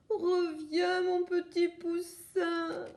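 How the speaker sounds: mother hen calling her last egg; cry out. Acted. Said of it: "La poulette s'écrie".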